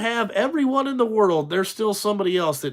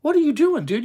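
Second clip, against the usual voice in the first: normal talking voice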